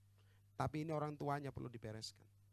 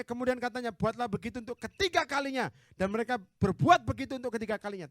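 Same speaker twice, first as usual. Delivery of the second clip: loud, raised-pitch Bible reading; forceful scripture reading; 7 semitones higher, 13 dB louder than usual. Real, not acted. Preacher reading Elijah's altar passage, forceful scripture reading. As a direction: declaim the verse to the whole church.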